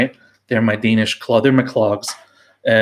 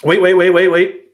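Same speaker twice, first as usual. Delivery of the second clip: sudden excitement; 7 semitones higher, 7 dB louder than usual. Real, not acted. Spots Brewdog in the shed.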